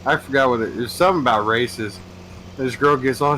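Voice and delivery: ordinary talk, nothing marked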